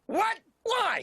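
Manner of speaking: high, startled outburst; indignant disbelief. Acted. Detective told to take leave by his partner, indignant. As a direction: burst out in high-pitched protest.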